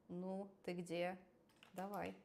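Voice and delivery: normal talking voice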